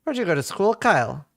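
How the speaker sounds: imitated prying cabbie; retold taxi driver. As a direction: play the taxi driver probing. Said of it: "eventually he goes like".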